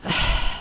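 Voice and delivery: soft, weary exhale; quiet huff of a sigh; exasperated sigh. Real, not acted. Exasperated at the show's logic.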